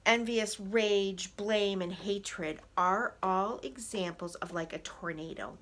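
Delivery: ordinary delivery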